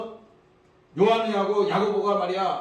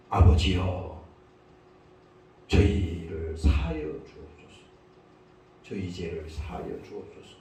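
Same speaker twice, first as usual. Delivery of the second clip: dying prayer; 12 semitones lower than usual. Acted. Jesus on the cross prays for forgiveness, low solemn plea. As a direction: plead to the Father in a low, heavy voice. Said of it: "예수님의 마지막 유언이야".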